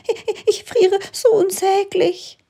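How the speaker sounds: a tiny frozen hare crying out miserably; wailing little hare. A narrator's reading. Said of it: "Der schreit und jammert kläglich". (hoher, kläglich jammernder Hase)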